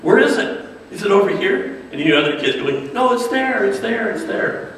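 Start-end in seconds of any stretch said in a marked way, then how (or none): 0.0-1.8 high, rising, exaggerated searching questions; kids' show host. Blue's Clues host asks kids at home, playfully puzzled. 2.9-4.7 put-on high, insistent child-like pointing-out; imitated excited kids. Kids at home answer the TV host, mock urgent.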